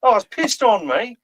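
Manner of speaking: an Aussie yelping at a drop bear; Australian accent. Retold or imitated. Australian bloke pissed on by koala, fast high Australian accent. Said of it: "That's a pretty fucking good Australian accent".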